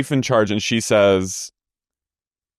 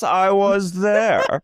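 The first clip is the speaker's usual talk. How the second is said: slow, higher drawn-out admission; mock Meredith; 9 semitones higher, much slower than usual. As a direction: drag it out like a dazed Meredith. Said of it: "And Meredith goes".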